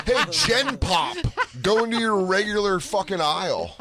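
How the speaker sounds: high-pitched snobbish heckle; haughty mock taunt. Rich fan sending commoners away, haughty taunt.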